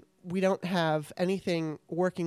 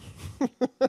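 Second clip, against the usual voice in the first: a short bright burst of laughter; real laugh; 6 semitones higher than usual. Real, not acted. Host laughs at guest's joke.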